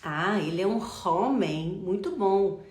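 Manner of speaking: example sentence with "homem" begun on a breathy H; mock sentence, "homem" said with English-style H; wrongly sounded H. Shown as a demonstration. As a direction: stress "homem" and aspirate its H, mimicking the mistake. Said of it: "nós não falamos".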